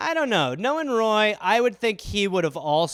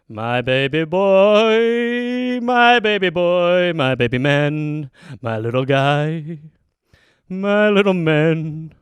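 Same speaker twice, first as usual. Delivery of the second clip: slow, drawn-out cooing; 3 semitones lower, 5 dB louder, slower than usual. Real, not acted. Cooing to the cat.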